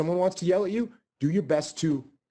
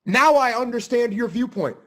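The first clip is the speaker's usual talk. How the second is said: sarcastic mock thanks; 5 semitones higher, 4 dB louder than usual.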